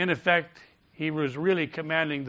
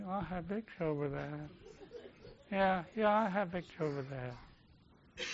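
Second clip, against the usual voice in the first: feeble, much softer mock declaration; half-hearted; 2 semitones higher, 12 dB quieter than usual. Shown as a demonstration. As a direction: mimic a limp, dragging, noncommittal recital. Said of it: "I don't want you to just".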